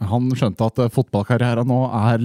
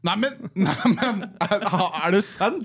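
laughing disbelief; 5 semitones higher, 5 dB quieter than usual. Real, not acted. Reaction to Eriksen signing news.

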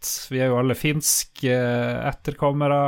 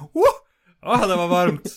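high, loud gasp of relish; exaggerated show of cosy warmth; mock exclamation; 5 semitones higher, 4 dB louder than usual. Teasing a freezing partner with cold hands, high exclamation. Acts out being smugly warm.

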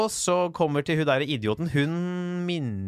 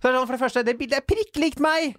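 indignant; 11 semitones higher than usual. Real, not acted. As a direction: protest that the photo is obviously him. Indignant about his ID photo.

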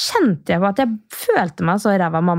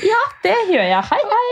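much higher, breezy sing-song goodbye; a mock cheerful farewell; cheeky breeziness; 14 semitones higher than usual. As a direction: brush it off brightly. Cheeky reply to mother-in-law.

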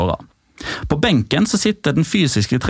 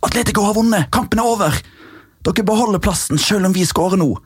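urgent claim; 8 semitones higher than usual. Retold or imitated. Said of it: "går bort til Granada-kaptein".

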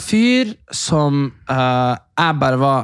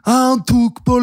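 mock teen exchange; 9 semitones higher than usual. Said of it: "Så var jeg sånn".

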